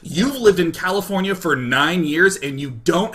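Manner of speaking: loud, indignant disbelief; incredulous cop. Retold or imitated. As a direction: throw the number back in outraged disbelief. Officer incredulous at nine years.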